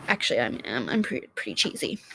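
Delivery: sheepish admission. Real, not acted. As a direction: admit it under your breath. Confessing being cheesy.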